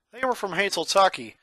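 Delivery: ordinary delivery, nothing marked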